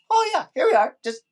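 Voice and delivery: very high mock casual. Podcaster mocks Rangers breathing in space, very high and casual. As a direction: squeak it out casually.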